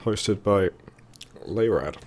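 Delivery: hoarse, strained voice from a sore throat; talking through a sore throat. Real, not acted. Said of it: "I've got a sore throat from a virus".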